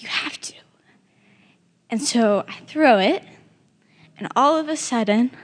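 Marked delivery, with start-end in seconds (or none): 0.0-0.5 soft unvoiced whisper. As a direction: breathe it as a gentle command. God's answer, retold.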